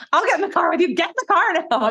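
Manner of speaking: high, insistent put-on voice; mock-pushy woman; Sonia impression. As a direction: order them along, high and urgent. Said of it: "She's like".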